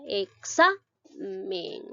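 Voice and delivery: Examen very slowly split, "e-XA-men"; stress on "xa" in Examen. Shown as a demonstration. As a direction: hold the strong "xa", pause, then finish Examen. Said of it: "exa lleva la mayor fuerza de voz". (Examen muy lento, con la fuerza de voz en "xa")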